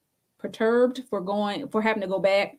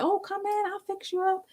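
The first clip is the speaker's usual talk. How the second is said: put-on much higher and softer, lightly accented; nail shop husband; 10 semitones higher, 6 dB quieter than usual. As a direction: imitate the husband's soft welcome. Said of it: "he was like".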